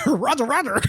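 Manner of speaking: high-pitched mock radio chatter; imitated toy radio call. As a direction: squeak it out like a toy's audio clip. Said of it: "and she goes".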